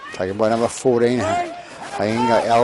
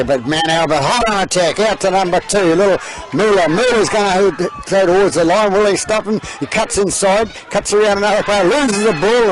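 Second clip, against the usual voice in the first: rising, louder play-by-play as the attack builds; excited commentary; 8 semitones higher, 4 dB louder than usual. Real, not acted. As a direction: call it faster and higher as he nears the line. Live commentary building to climax.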